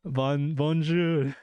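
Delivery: a slow, drawn-out put-on French accent; bad French accent. A viewer asks for a French impression, so a slow put-on French accent. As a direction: stretch out a cheesy French bonjour. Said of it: "best French impression".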